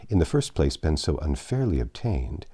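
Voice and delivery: plain reading voice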